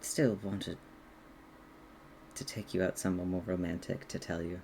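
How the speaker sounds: soft, low, halting confession; confessing dragon. Acted. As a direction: confess quietly, with long hesitant pauses. Game master plays confessing dragon.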